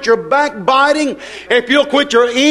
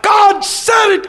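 high, slow shout of faith; triumphant shout; 11 semitones higher, slower than usual. Acted. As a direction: proclaim it slowly at full cry. Believer's triumphant exclamation quoted.

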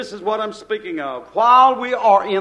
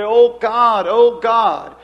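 pleading cry; 7 dB louder, slower than usual. Acted. Acted prayer of hunger.

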